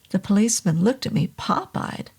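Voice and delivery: usual speaking voice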